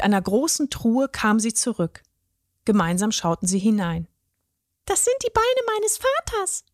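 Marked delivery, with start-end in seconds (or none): 4.8-6.6 very high fairy voice, proud; showing off her father's legs. A narrator's reading. The fairy shows the troll her father's legs, high and proud. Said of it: "sagte sie stolz".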